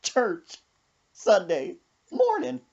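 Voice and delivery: put-on high, giggly old lady voice; grandma laughing. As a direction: squeal it like a grandma who can't stop laughing. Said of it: "She said".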